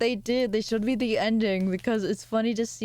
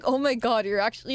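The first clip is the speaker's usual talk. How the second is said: normal talking voice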